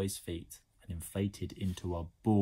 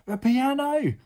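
high, loud panicked yell; boy screaming out; screamed; 12 dB louder, slower than usual. A narrator's reading. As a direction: yell an urgent warning. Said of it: "Now he was screaming too".

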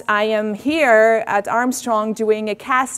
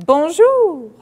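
high, louder Bonjour from the guy in the song; acted greeting; 9 semitones higher, 6 dB louder than usual. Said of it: "the guy says".

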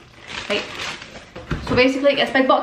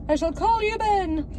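high, slow, mock-regal decree; mock grand naming; 8 semitones higher, 4 dB quieter, slower than usual. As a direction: dub the fly with hammed-up ceremony. Naming a fly.

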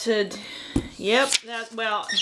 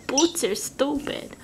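slow, higher, hammed-up Canadian vowels; Canadian accent; 5 semitones higher than usual. Artist mocking her own accent, exaggerated slow Canadian accent. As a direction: overdo a Canadian accent, drawn out. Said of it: "that's my Canadian accent coming out there".

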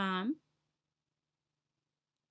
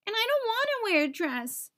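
sobbing; 10 semitones higher than usual. A narrator's reading. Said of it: "sobbed Nate".

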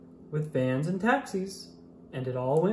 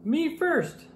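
puffed with pride; 12 semitones higher than usual. Acted. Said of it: "all puffed with pride".

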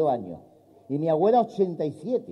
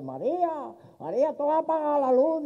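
put-on drunk calling wife; 11 semitones higher than usual. Drunk calls wife at night.

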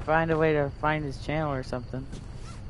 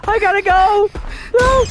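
panicked yelling; 13 semitones higher, 5 dB louder than usual. Real, not acted. Fleeing the killer, yelling.

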